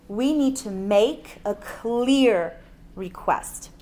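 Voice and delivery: slow, weighty stress on "clear"; emphatic CLEAR. Real, not acted. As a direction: stretch "clear" out so nobody misses it. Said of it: "I really emphasize the word clear".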